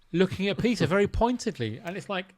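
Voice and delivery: ordinary talk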